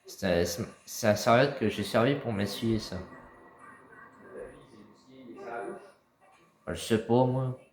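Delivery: shouting to someone in another room; calling out. Real, not acted. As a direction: call it out loud across the house.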